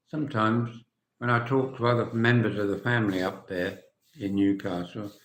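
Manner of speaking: ordinary delivery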